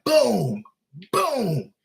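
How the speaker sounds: high, punchy mock impact sounds; bat hit sound effect. Kevin swinging the bat at the debtor, mock impact booms. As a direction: make the bat land with a sharp boom.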